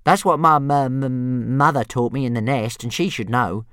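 bright stammer of a small dragon; cheery dragon stutter; cheerful stuttering dragon. A narrator's reading. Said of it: "said Toothless, cheerfully".

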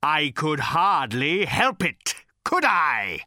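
high, slow, bellowing rage. A narrator's reading. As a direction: bellow out years of grievance. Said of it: "he bellowed".

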